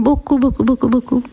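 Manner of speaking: beaucoup rattled off in a quick run; playful rapid repetition. Shown as a demonstration. Teacher hints at a treat, beaucoup repeated rapidly. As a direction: chain the repeats together without pauses.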